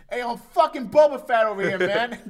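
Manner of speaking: very high, very loud mock yell; over-the-top screamed boast; hammed-up shout.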